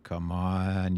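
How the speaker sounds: ordinary delivery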